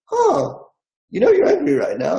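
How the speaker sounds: a light, high "huh" as if catching yourself angry; inner voice noticing. Retold or imitated. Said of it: "simultaneously say".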